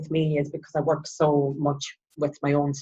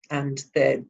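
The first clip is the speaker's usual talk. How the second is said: ordinary delivery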